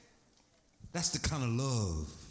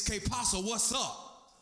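Puerto Rican slang greeting; 8 semitones higher than usual. Retold or imitated.

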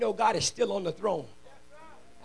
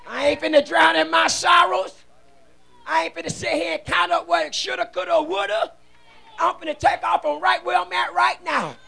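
loud, defiant declaration of faith; fervent preacher shouting testimony; full-force preaching; 7 semitones higher, 9 dB louder than usual. Real, not acted. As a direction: declare it boldly, pushing up and out. Preaches to congregation.

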